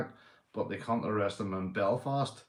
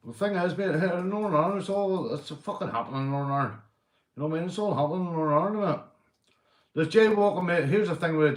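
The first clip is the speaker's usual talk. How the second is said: higher, fast and louder; worked-up rant; 7 semitones higher, 3 dB louder, faster than usual. Real, not acted. Ranting to viewers about Northern Ireland, higher and rushed. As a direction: rattle it off, wound up.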